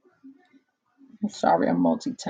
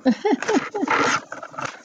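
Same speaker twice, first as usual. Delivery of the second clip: giggle; 12 semitones higher than usual. Real, not acted. Laughing at own chewing.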